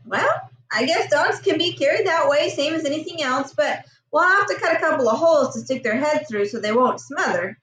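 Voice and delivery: usual speaking voice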